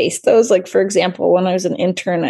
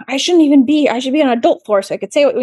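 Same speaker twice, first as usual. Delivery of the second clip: mock rant, higher and hurried; imitated ranting; 6 semitones higher, 3 dB louder, faster than usual. Patient protesting being on the pediatric floor, mock ranting. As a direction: rattle off an indignant complaint, imitating him. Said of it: "he just kept ranting and raving".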